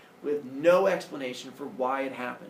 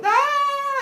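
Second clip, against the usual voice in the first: high, loud horrified shriek; mock villagers' scream; 9 dB louder than usual. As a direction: scream again in cartoon horror. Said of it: "they start screaming like".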